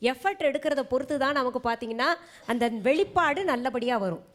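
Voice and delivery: normal talking voice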